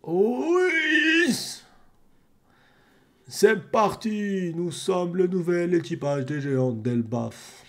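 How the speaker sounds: high then slow proud put-on voice; dubbed giant captain. Streamer voicing the giant captain's slow proud announcement.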